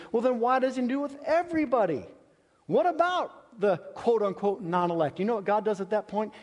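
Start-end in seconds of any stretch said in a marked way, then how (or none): 0.1-5.2 mock objector, higher and quick; skeptical listener's objection. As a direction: voice the doubter's protest, a little sharper. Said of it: "the first thing you're thinking is".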